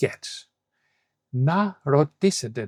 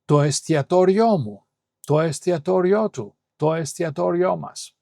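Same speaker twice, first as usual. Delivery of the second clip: "εστιατόριό" higher, stressed on τό and final ό; double stress; 4 semitones higher, 3 dB louder than usual. Shown as a demonstration. Teacher drilling more examples for learners, two stresses on "εστιατόριό". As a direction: punch both accents in "εστιατόριό" before each pronoun. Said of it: "You can do it with many words".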